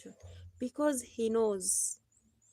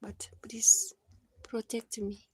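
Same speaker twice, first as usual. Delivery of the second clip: soft, hushed plea to God; whispered prayer; 6 dB quieter than usual. Retold or imitated. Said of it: "And I was like".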